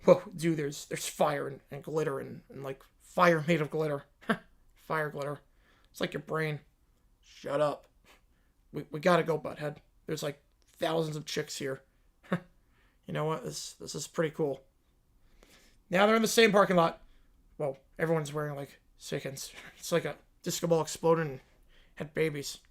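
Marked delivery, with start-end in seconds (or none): 0.0-14.6 put-on lower, softer dopey teen voice; Beavis and Butthead. Teens gawking at a concert ad, dopey. 17.6-22.6 put-on lower, softer dopey teen voice; Beavis and Butthead. Teens gawking at a concert ad, dopey.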